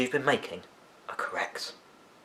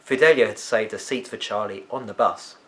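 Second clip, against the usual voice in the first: ordinary delivery, nothing marked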